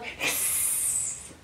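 breathy hiss with no voice; cat hiss. Shown as a demonstration. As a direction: show the kids an angry cat's hiss. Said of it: "now I'm an angry cat".

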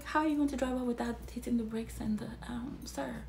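fast, incredulous whisper. Real, not acted. Scolding the guy who can't drive off, whispered. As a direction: hiss it like scolding him.

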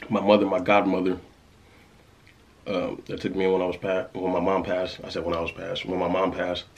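snack held at lips; speaking between bites; talking while eating. Real, not acted. Seen 0: Clear face; holds snack piece, touches it to lips while talking.

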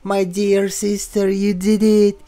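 high, sweet-talking sibling voice; game character. Acted. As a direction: act a character greeting a dear sister, pitched high. Voices the game's sister character.

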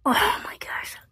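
breathless little whisper; awed whisper. Real, not acted. Watching her healthy fish, whispering in awe.

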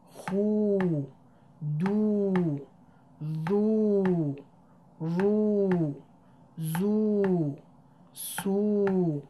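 each letter sounded with a long stretched او vowel; drawn-out oo sounds. Shown as a demonstration. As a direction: chant every syllable holding the long او. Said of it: "اگر صداش رو بخواید بشنوید روی حروف الفبا".

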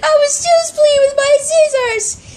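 long high wail into tearful words; pretend crying. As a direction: wail it out as a hurt doll. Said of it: "now she's really crying".

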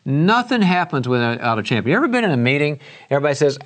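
ordinary delivery

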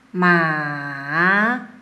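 "ma" low, dipping then rising; third-tone demo. Shown as a demonstration. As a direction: sink "ma" low, then bring it back up. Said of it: "esa sílaba con diferentes, ah, tonos".

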